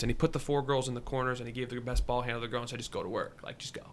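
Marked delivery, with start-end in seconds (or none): 2.8-3.8 very soft, low retold coaching; deadpan instruction. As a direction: drop it quietly as if that's all. Retells coach's minimal game plan.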